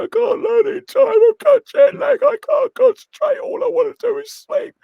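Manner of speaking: very high, loud mock wail; whining complaint. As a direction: wail it out in squeaky, hammed-up despair. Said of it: "I've never done this and complain".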